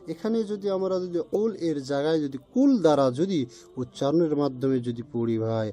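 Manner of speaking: ordinary talk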